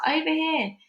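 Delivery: high, lightly projected call. A narrator's reading. Father directing child towards him, calling out. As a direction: guide someone over with a call. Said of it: "the voice called out".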